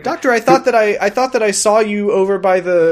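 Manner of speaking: lightly acted high, loud query; mock-innocent questioning of the Doctor; puzzled companion. Voicing a character questioning the Doctor.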